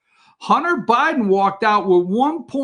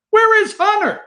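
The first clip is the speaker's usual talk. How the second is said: high-pitched mock holler; calling out; 11 semitones higher, 4 dB louder than usual. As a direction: shout it out as if hunting for someone. Calling out for the absent Hunter.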